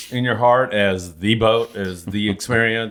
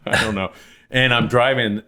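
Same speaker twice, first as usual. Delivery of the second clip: ordinary delivery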